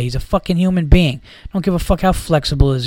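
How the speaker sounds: usual speaking voice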